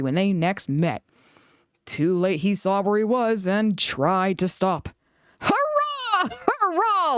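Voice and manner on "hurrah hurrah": much higher gleeful cheer; gleeful trucks. A narrator's reading. Trucks pushing James downhill, high gleeful cheer. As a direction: crow with mischief. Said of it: "laughed the trucks".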